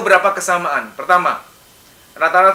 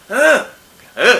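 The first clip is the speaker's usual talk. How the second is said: short barked heh, pitched up; mock angry shout; 5 semitones higher, 5 dB louder than usual. Shown as a demonstration. Psychologist demonstrating a possessed girl's angry yell. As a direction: act out the pent-up yell. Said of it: "teriakan satu yang karena marah sama si A".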